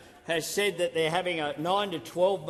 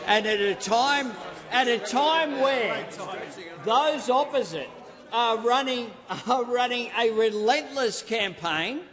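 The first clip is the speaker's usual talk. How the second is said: raised, forceful shouting over the chamber; 7 semitones higher than usual. Real, not acted. Heated parliamentary attack on opposition.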